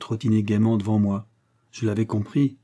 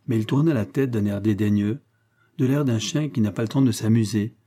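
ordinary delivery, nothing marked

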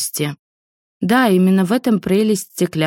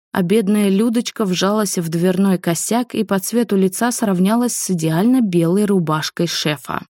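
usual speaking voice

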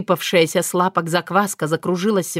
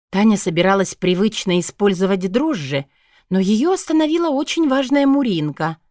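ordinary delivery

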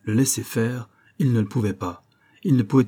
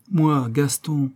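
slow, drawn-out, pleading and higher; plaintive; 5 semitones higher, much slower than usual. Acted. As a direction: stretch the name out pitifully. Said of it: "répondit une voix plaintive".

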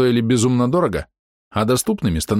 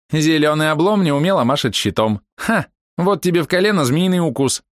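high, sing-song jeer; mocking taunt; 8 semitones higher than usual. Archer girl jeers at the half-orc from the grove. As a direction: tease the clumsy tank. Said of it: "насмешливых подколках".

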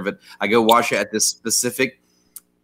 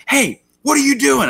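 high-pitched scolding yell; mock screech of accusation; retold scream; 14 semitones higher, 3 dB louder than usual. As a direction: imitate an employee hollering at a customer. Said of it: "She screams".

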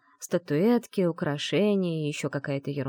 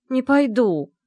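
higher, louder, digging in; stubborn refusal; 8 semitones higher, 8 dB louder, slower than usual. Acted. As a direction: refuse flatly, loud and firm. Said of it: "уперлась я". (упрямо и громко)

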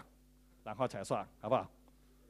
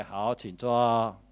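ordinary delivery

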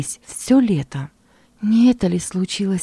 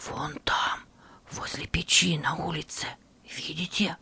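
whisper; 11 dB quieter than usual. Acted. Hiding, pointing at enemies.

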